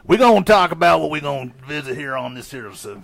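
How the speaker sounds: mock hillbilly voice. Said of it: "Stop using big words".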